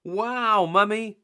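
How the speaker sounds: high, loud amazed wow; be dazzled; gasp of wonder. Acted. Peppa amazed at Mummy's catch, high and loud.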